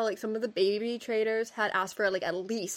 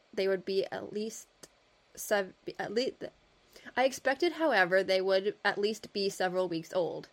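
put-on measured reading of an old article; steady reading-aloud voice; story narration. A narrator's reading. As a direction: read it like a period storyteller.

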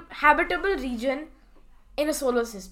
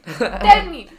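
laughing; 10 dB louder than usual. Real, not acted. Laughing demand.

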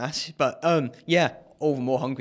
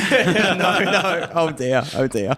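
laughing through the words; amused protest, half laughing; 5 semitones higher, 7 dB louder, much slower than usual. Real, not acted. Teased about marathon finish.

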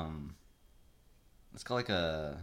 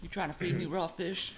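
mock outraged caller; 9 semitones higher than usual. Joking caller line.

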